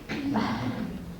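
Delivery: a long whispery sigh; breathy Gollum sigh. Acted. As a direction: sigh out before begging. Gollum sighs before pleading.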